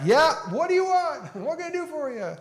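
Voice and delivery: a pretend Jesus shouting back across the road with a laugh; mock yell back. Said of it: "and yelled back".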